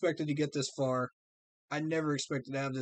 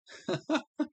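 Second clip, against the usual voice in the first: a quick, high burst of laughter; a sudden laugh high in the voice; 12 semitones higher than usual. Real, not acted. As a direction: break into a bright laugh. Laughs during his stream.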